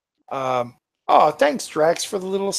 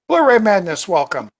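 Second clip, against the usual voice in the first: loud, high welcome; loud greeting; 4 semitones higher, 7 dB louder, faster than usual. Real, not acted. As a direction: call out a greeting.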